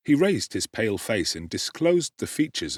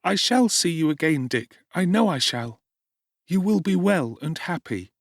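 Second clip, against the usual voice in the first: put-on young Oliver; 8 semitones higher, 4 dB louder than usual. A narrator's reading. Oliver asking Dick at dawn, high anxious boy. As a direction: ask anxiously as a runaway boy.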